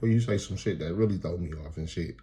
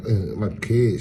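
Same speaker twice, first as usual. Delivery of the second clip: ordinary talk, nothing marked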